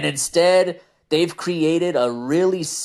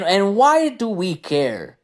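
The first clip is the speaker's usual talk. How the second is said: ordinary delivery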